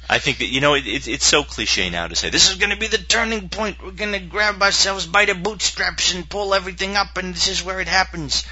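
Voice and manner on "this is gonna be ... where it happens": high-pitched hillbilly pep talk; hillbilly soldier. Acted. Host mocking cliche turning-point talk, hillbilly soldier voice. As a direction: put on a high, hokey soldier voice. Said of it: "hillbilly soldier kind of thing".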